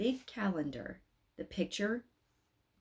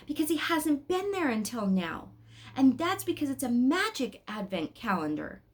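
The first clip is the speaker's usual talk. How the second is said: put-on a boy, sure of himself; 6 semitones higher, 4 dB louder than usual. A narrator's reading. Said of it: "said Joachim".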